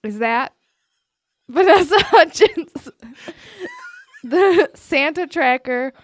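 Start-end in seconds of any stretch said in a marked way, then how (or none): 1.0-5.9 high, loud laughing through the words. Real, not acted. Host reading out quiz choices, laughing helplessly. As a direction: read the choices while cracking up.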